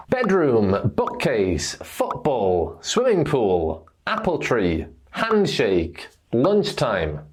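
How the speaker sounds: BEDroom, BOOKcase, FOOTball, falling after the first noun; stress first noun. Shown as a demonstration. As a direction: hit "swimming", "apple", "hand", "lunch", let the second noun drop. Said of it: "we stress the first noun".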